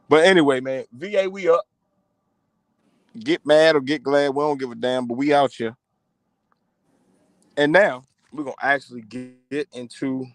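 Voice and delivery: normal talking voice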